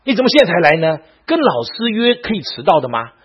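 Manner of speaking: loud, high-pitched telling-off of a late student; teacher's irate dressing-down, acted out; angry scolding. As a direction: berate them at full volume. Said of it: "他就开始骂".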